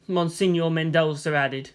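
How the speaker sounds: ordinary delivery, nothing marked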